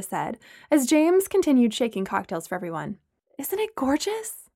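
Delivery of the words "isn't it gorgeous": admiring sigh. A narrator's reading. Amanda admiring the sunset.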